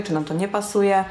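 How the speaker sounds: ordinary talk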